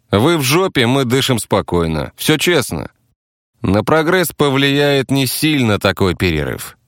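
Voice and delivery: defensive taxi driver. Acted. Taxi driver explains the attack as prevention, defensive.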